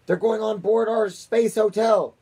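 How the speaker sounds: slow, high-pitched loud shout. A narrator's reading. President shouting about the space hotel, loud shout. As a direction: shout each word out, high and drawn out. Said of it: "shouted the president".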